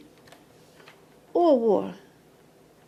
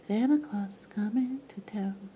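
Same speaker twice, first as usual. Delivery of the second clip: hushed, breathy song line; whispered lyric; 2 semitones lower, 4 dB quieter than usual. Retold or imitated. Vlogger finishing the Santa carol, whispered. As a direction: whisper it like a secret.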